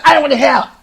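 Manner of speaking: loud, curt teacher voice; mock refusal. Teacher refusing to help the bullied boy, loud and curt. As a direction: shut him down hard.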